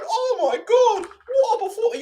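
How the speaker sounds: mock gushing in a high, squealing voice; hammed-up, stretched-out excitement, way up high; hyped-up fan. Streamer mocking people hyped up about this fight, over-excited. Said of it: "getting all hyped up about this fight".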